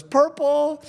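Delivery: put-on high, eager child's answer; a kid calling out a color. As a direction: pipe it out like a small kid. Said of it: "some other kid goes".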